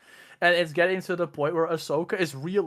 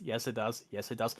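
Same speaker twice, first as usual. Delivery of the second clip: hushed; 6 semitones lower, 8 dB quieter than usual. Retold or imitated. Emperor hushed self-correction.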